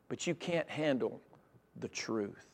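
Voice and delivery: low, slow, hushed delivery; quiet retold warning. Preacher voicing the prophet's hard truth to the king, quiet. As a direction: let it drop softly, with a pause before the truth.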